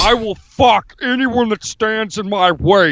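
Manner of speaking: hammed-up tough-guy politician impression; comic strongman bluster; Jesse Ventura. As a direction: declare it like a blustering off-grid candidate. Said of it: "You sound like Bruce Jenner doing Jesse Ventura".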